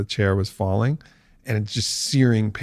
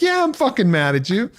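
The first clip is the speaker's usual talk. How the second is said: much higher, hammed-up anger; mock angry; 11 semitones higher than usual. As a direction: blurt the hidden anger with a laugh. Inner angry voice.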